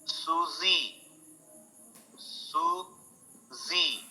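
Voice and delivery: soft "Susie" with a z; quiet drawn "Susie" with a buzz; American Susie. Shown as a demonstration.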